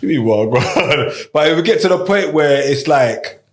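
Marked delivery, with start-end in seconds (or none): 0.0-1.3 slow, lower, puzzled "You mean Wagwan?"; drawn-out, lightly voiced confusion; mock puzzled question.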